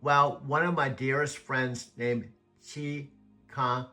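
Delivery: usual speaking voice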